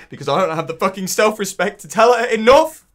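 high, loud, faltering outpouring; desperate outburst. Acted. Man unable to tell her enough, desperate outburst. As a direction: burst out high, then stumble over the words. Breaks down over failing her.

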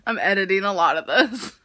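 ordinary talk

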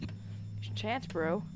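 slow hushed urging; slow whisper. Acted. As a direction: breathe it out slowly. Urging partner in game.

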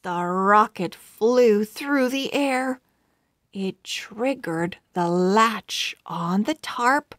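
ordinary delivery, nothing marked

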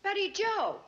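calling her name across the yard. Acted. Mother calls daughter from afar.

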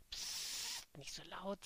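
urgent soft shush; whispered warning; hushing. Acted. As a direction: hush them before anyone hears. Jenny hushing friends.

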